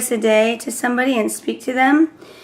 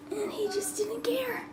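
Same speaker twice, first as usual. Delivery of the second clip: quick whisper with a worn-out voice; whispering because her voice is gone; lost voice; 10 semitones higher, 15 dB quieter than usual. Real, not acted. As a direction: rush it out in a whisper. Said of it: "my voice is messed up".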